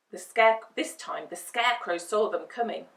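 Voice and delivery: plain reading voice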